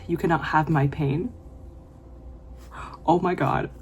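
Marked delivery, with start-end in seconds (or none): none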